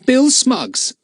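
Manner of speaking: put-on boy explaining to the smugglers; boy's voice. A narrator's reading. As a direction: answer like an eager schoolboy. Philip names his friend.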